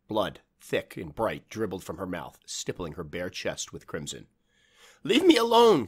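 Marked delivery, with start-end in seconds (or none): 5.0-5.9 a terrified full-voice shout, high and loud. Acted. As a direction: scream it back at the thing outside. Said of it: "Clara shouted back".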